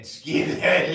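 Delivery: mock dad call. Dad joking about Russell Crowe.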